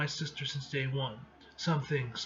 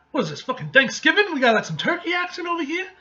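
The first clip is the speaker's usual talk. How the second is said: fast, much higher, laughing as he jokes; excited joking; 12 semitones higher, 10 dB louder, faster than usual. Real, not acted. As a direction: crack up at the dinner scene, talking fast. Joking about comic's dinner scene.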